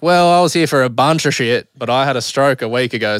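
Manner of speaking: blurted answer, acted out; exasperated reply. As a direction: throw it back loudly, mock-exasperated. Acts out his blunt reply.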